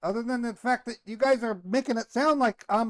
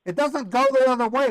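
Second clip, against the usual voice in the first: louder and higher, talking over the caller; raised indignant voice over interruptions; indignant shout; 6 semitones higher, 5 dB louder than usual. Real, not acted. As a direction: insist hard, cutting him off. Victim argues his innocence.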